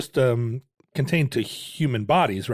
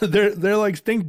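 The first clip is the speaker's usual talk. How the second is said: laughing; 8 semitones higher than usual. Real, not acted. Laugh heard.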